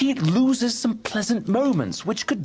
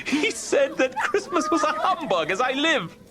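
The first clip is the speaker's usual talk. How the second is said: talks through laughter, pitch well up; giggling out the tale; laughing; 8 semitones higher than usual. Acted. Nephew tells his wife about Scrooge, laughing.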